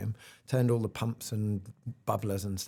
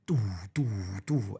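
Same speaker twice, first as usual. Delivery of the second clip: drum fish call; 3 semitones lower than usual. Shown as a demonstration. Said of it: "through your regulator go".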